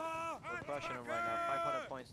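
high, slow gleeful crowing; excited. Real, not acted. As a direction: crow about the lead. Excited over winning.

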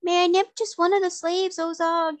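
high-pitched pleading creature; wheedling little creature asking its master. A narrator's reading. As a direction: beg in a small, high monster voice. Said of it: "it asked pleadingly".

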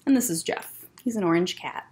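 plain reading voice

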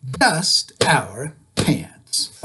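very slow, drawn-out chant. Shown as a demonstration. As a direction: stretch each word out slowly. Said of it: "Say it one more time".

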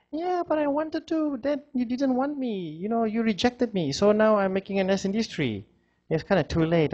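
high, hurt little squirrel. Acted. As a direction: turn the tree down in a high wounded voice. Squirrel rejects tree back.

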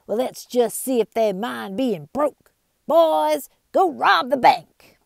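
loud, high furious outlaw. Acted. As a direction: rage at the whole town. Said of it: "Bart was furious".

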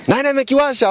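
high-pitched mocking phone-chatter voice; put-on high voice, poking fun; mock WhatsApp spokesman. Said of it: "Msemaji huwezi kufanya kazi kwa, kwa WhatsApp".

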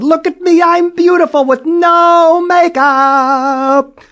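very high, loud, slow joy; delighted girl. Acted. Julie thrilled at the mirror, very high and loud. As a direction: burst with delight.

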